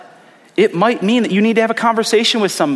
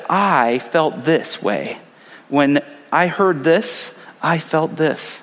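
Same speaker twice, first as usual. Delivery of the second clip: calm I statement; 2 semitones lower, much slower than usual. Retold or imitated. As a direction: own the feeling gently, slowly. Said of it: "instead of".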